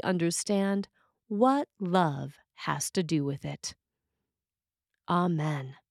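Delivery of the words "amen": ordinary talk, nothing marked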